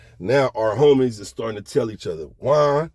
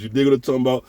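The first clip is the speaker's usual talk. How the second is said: normal talking voice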